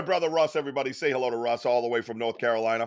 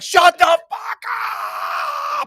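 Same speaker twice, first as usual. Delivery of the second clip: high slow mock yell; retold yell; 6 dB louder, slower than usual. Retelling yelling at neighbor Pat, high and slow. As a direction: yell shut up, stretched out. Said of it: "I was like".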